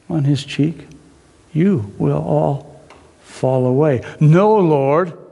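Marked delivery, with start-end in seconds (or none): 1.5-4.0 slowed, grave repeat; Jesus warning the eleven, slow and grave; slow solemn Jesus. Acted. 4.2-5.2 loud, quick protest; Peter protesting. Acted.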